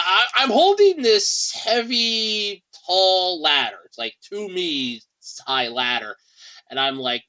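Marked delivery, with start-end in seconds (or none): none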